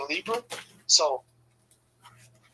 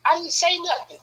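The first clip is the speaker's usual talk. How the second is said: high, defensive denial; imitating his own nervous denial; mock innocent protest; 12 semitones higher than usual. Playing innocent in a retold fight.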